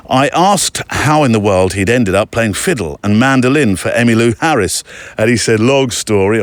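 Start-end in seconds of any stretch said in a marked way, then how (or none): none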